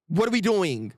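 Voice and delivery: usual speaking voice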